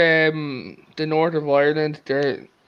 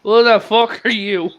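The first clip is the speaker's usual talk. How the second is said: gruff grandad; 5 semitones higher, 3 dB louder than usual. Retold or imitated. Grandad answers granddaughter rudely.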